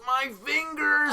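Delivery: high, slow strained cry of pain; pained squeal. Retold or imitated. Retelling a coworker crying out about his squeezed fingers, high slow pained squeal. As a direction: stretch it out like your fingers are being crushed. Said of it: "And he goes".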